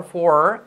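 "for" with a strong rolled-in R. Shown as a demonstration. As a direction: lean hard on the R of "for". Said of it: "reforzar la R". ("for" con la R reforzada)

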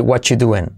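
"What you doing" without "are"; most reduced form. Shown as a demonstration. Teacher offers shortest form, casual "whatchu doing". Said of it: "y puedes decir".

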